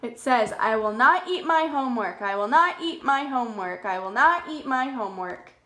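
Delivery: normal talking voice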